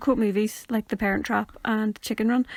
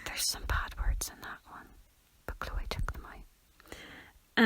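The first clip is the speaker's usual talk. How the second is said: soft, slow whispered aside; hushed confession to viewers; 11 dB quieter, much slower than usual. Real, not acted. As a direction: whisper it like a secret.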